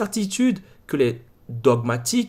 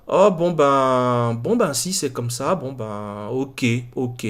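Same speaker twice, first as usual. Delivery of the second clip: disappointed acceptance; 4 semitones lower, slower than usual. Retold or imitated. Guest told the skeptic only wants to debate, disappointed. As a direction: give in with a drawn-out, deflated "oh, bon ben". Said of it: "elle était un peu déçue, elle fait".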